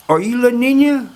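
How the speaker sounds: high-pitched mock disbelief; incredulous question. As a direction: quiz him as if you can't believe it.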